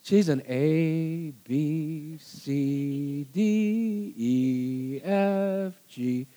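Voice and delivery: painstaking plod through each letter; slow, step-by-step. Acted. Husband mimicking wife's way of doing things, slow and methodical. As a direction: spell it out very slowly, letter by letter.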